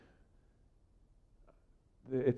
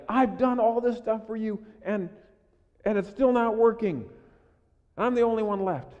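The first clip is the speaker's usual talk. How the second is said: higher, louder, fed-up complaint; exasperated Elijah; 9 semitones higher, 6 dB louder than usual. Retold or imitated. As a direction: vent like a burned-out prophet. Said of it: "He's like".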